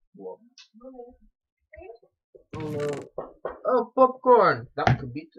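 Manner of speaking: ordinary delivery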